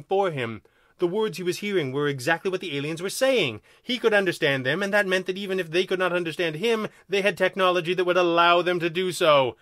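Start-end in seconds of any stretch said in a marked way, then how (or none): none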